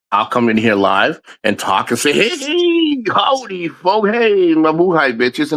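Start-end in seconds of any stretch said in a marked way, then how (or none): 2.1-5.5 high-pitched, drawn-out "hey" greeting; hyped livestream greeting. Acted. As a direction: sing out a showy hello, starting very high. Mimics own livestream greeting.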